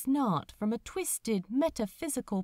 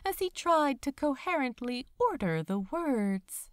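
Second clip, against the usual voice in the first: ordinary delivery, nothing marked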